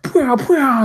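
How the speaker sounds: high, loud pew-pew blaster noise; blaster sound effect. Acted. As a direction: spit out blaster shots with the mouth. GM voicing blaster fire.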